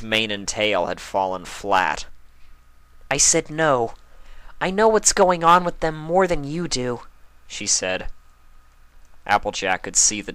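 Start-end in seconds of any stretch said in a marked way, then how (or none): 3.1-7.1 high, louder stubborn no; insistent Pinkie. A narrator's reading. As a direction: hold back tears while refusing. Pinkie refuses, near tears.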